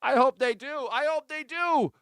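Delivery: high, squeaky mocking agreement. Mocking the crowd in a squeaky high voice. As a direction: chirp it like a cartoon fan.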